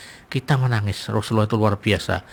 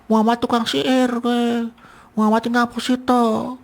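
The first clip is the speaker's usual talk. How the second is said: mocking the blind beggar; 12 semitones higher than usual. Preacher voicing the beggar insulting Muhammad, high scornful put-on voice. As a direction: sneer like the blind beggar cursing the Prophet. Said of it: "Mencaci seperti itu".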